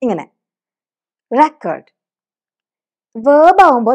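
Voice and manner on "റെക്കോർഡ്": റെക്കോർഡ് with the first part റെ hit; റെക്കോർഡ്, weight on റെ; stress on RE. Shown as a demonstration. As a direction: say റെക്കോർഡ് as RE-cord, noun. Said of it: "capital ലെറ്ററിൽ എഴുതി വെക്കാം".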